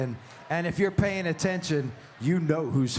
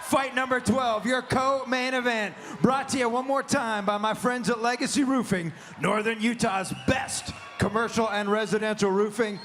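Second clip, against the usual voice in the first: booming fighting game announcer; 5 semitones higher than usual. Acted. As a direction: pump up the crowd. Ring announcer hyping crowd.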